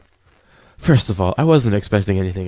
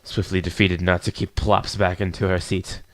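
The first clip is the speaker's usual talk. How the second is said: normal talking voice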